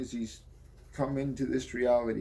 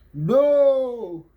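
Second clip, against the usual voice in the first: much higher and louder, a full yell; desperate shout; 11 semitones higher, 11 dB louder than usual. Acted. Pitt yelling as he's ripped between worlds, desperate shout. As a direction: scream it out as he grabs her.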